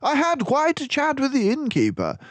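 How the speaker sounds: high, loud, mannered detective voice; Basil's voice. Acted. Basil reassures gloomy Dawson on the train, high and hearty. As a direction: play the confident mouse detective.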